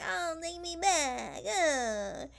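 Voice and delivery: put-on begging, dragged and theatrical; mock pleading. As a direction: drag it out as a whiny fake plea. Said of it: "all the pleas that she made to be like".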